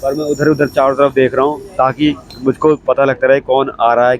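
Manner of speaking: ordinary talk, nothing marked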